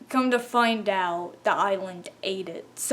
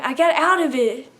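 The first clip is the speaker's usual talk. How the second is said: ordinary talk, nothing marked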